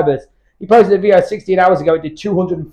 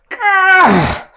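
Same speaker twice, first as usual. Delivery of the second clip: strained cry; 12 semitones higher than usual. Real, not acted. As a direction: let the last rep tear out of you. Final rep of the set.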